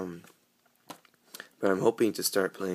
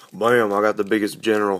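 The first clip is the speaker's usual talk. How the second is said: louder, punchy pretend brag; playful mock boast; 7 dB louder than usual. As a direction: show off to friends in mock triumph. Imagined boast to friends at play.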